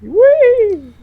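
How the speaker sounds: high, loud whee of joy; gleeful squeal. Real, not acted. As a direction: squeal with delight. Happy about the hit.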